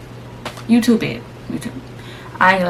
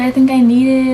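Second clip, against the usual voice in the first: retold hedged request, pitched up; tentative request; 7 semitones higher, 7 dB louder than usual. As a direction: ask for relief without demanding it yet. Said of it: "I need it now".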